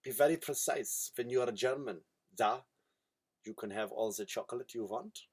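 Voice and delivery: put-on soft, low comic German accent; mock German accent. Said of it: "be, be German".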